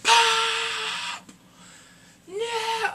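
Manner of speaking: very high, drawn-out holler; anguished mock cry. Fan reliving Pop getting shot, high mock-anguished cry. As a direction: wail the name in hammed-up shock. Acting out the shocking death.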